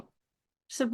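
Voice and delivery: ordinary delivery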